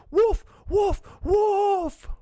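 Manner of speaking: high, loud frantic cry; panicked shouting. A narrator's reading. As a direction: scream for help for real this time. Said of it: "Then he started shouting".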